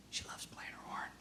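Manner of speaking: hushed, breath-only delivery; emphatic whisper. Real, not acted. Speaker tells teachers what the child cares about, whispered. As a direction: whisper it like a precious truth.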